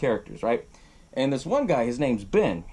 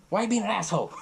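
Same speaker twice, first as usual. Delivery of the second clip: mildly acted scolding dad, raised pitch; tough-love dad; 6 semitones higher, faster than usual. Tough-love dad having a go at his son, mock scolding. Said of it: "the tough love dad".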